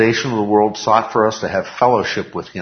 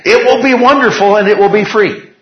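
higher, louder, beaming promise; excited; 11 semitones higher, 8 dB louder than usual. Real, not acted. Teacher to class promising heaven is free, excited and louder. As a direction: sell the joy of it, lifted up. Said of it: "excited promise of heaven".

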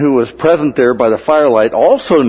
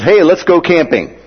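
higher, mock-eager suggestion; eager outburst; 7 semitones higher, 4 dB louder than usual. Outspoken Peter suggests tents at the Transfiguration, mock eagerness. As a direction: blurt it out high, playing eager Peter.

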